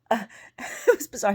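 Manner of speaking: high squealing laugh into the words. Real, not acted. Laughing as she retells the scammer's come-on. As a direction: laugh it out, disbelieving.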